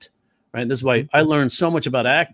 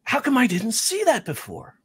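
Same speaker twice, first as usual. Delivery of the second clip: amazed; 8 semitones higher, 3 dB quieter than usual. Retold or imitated. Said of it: "makes you go".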